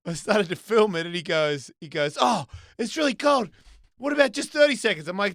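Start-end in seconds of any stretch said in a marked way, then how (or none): 2.2-5.1 imitating scared son. Son in the cold plunge.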